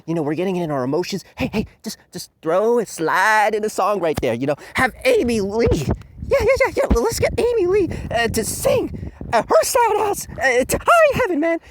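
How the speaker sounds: high, hyped-up mock voice of clueless producers; mocking studio execs. Studio execs pitching songs to the reviewer, hyped mock voice. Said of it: "They're like".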